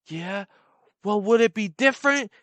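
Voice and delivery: high, loud taunting threat; a menacing dare with a tattoo reveal; threatening guy voice. Retold or imitated. Guy threatening, lifting shirt.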